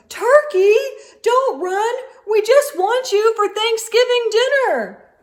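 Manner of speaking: high, loud call; calling after. A narrator's reading. Moose shouts after the fleeing turkey, loud call.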